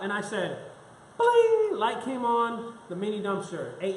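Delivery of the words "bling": high loud bling; bling sound effect. Retold or imitated. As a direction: ring it out like a bell. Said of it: "lightbulb moment sound".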